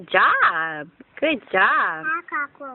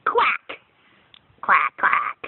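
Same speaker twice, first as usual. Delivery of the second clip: duck quack; 6 semitones higher, much slower than usual. Shown as a demonstration. Parent showing the kids a duck's sound.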